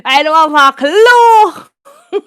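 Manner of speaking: loud, slow laughing wail. Real, not acted. Student recalling total confusion, laughing hard. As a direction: drag it out loudly through laughter. Cracking up over own story.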